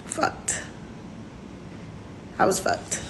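near-whisper, very soft; quiet, whispered admission; hushed confession. Real, not acted. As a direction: barely voice it, after a heavy pause. Painful confession about pregnancy.

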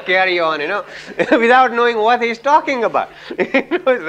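amused, high-pitched, laughing through the words. Real, not acted. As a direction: laugh while telling it. Laughing at Indians' habit of pretending.

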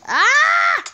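high, loud startled scream; panicked scream. Real, not acted. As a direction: shriek as the fire hits. Catching fire in game.

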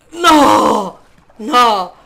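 loud anguished shout; yelling no. Real, not acted. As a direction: shout in dismay. Falls in game.

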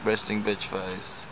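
slow, deadpan and flat. Shown as a demonstration. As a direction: say it slowly with a dead-flat face. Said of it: "It's for real".

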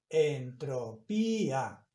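"entropía" broken up as en-tro-PÍ-a; syllable by syllable. Shown as a demonstration. Teacher showing how the written word is read, syllable by syllable. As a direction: slowly split "entropía", landing on the í. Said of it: "Esa palabra se lee".

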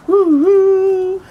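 high loud playful train call; train whistle woo-hoo. Pretend. Reading to kids, she hoots like the passing train. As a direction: hoot like a train going by.

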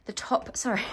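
breathless after climbing stairs; panting between words; out of breath. Real, not acted. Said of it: "I'm out of breath".

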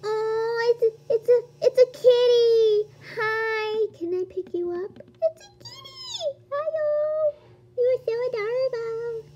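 high-pitched cooing over a pet; gushing squeal. Real, not acted. Player greeting an adorable kitty, high gushing coo.

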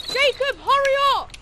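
high, loud call. Acted. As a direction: shout to him from ahead. Calling a lagging friend.

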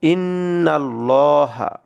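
ইন্নাল্লাহা slowly recited with a thick lam and rounded a; heavy lam, slow recitation. Shown as a demonstration. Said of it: "এই লামটাকে আমরা মোটা করে পড়বো".

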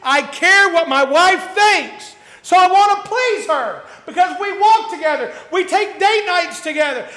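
high, loud, impassioned pulpit preaching; fervent preacher at full cry; full-force preaching. Acted. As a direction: preach it out, rising to a shout.

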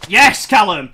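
loud, eager battle cry; excited hunt. Real, not acted. Spotting Callum to attack, loud eager excitement. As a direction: pump up for the kill.

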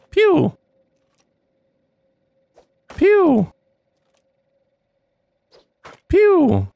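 high, loud playful pew sound effect; mock gunshot pew. Gamer voicing his own shots at an enemy, playful. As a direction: make a toy laser noise.

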